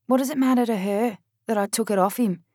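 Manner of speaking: ordinary delivery, nothing marked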